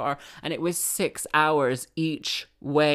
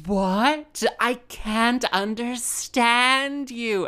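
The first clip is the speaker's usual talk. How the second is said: slow, higher-pitched patronizing complaint; condescending vicar; 8 semitones higher, much slower than usual. Retold or imitated. Vicar fails to understand the musician, slowly condescending. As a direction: draw it out high, talking down to her. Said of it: "This is how he talked to me".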